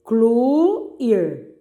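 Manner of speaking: "Excluir" broken into ex, clu, ir with pauses; separando sílabas. Shown as a demonstration. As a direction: dictate "Excluir" one drawn-out syllable at a time. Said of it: "separe as sílabas dessas palavras". ("Excluir" sílaba por sílaba, com pausas)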